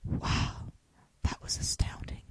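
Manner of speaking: breathy awed whisper. Acted. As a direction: whisper it in awe. Whispered heroine line.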